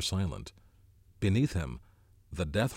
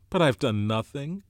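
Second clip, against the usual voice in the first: higher, louder urgent protest; 6 semitones higher, 5 dB louder than usual. A narrator's reading. Ralph protests to the twins he's done nothing, urgent. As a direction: plead your innocence urgently, voice raised. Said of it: "whispered Ralph urgently".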